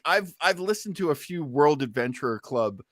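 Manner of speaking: ordinary talk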